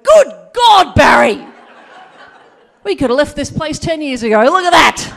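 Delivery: loud, high astonished yelling; shouted discovery to his mate Barry; amazed shout. Retold or imitated. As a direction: bellow in disbelief at finding the wheels. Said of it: "kicked the corrugated iron off and went".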